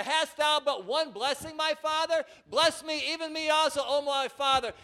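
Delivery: high, loud begging; pleading cry. A narrator's reading. Esau pleading with his father, high loud weeping plea. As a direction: plead through tears. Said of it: "Esau lifted up his voice and wept".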